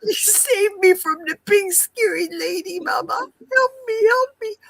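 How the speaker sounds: high pleading kitten cry; scared kitten voice. Pretend. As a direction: beg for help like a frightened kitten.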